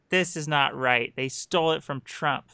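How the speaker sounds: ordinary talk, nothing marked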